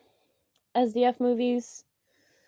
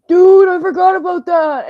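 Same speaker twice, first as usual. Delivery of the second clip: loud, higher, excited recall; excited quote; 9 semitones higher, 12 dB louder than usual. Retold or imitated. Friend excitedly remembering an old meme, loud and higher. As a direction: blurt it out, thrilled. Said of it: "someone being like".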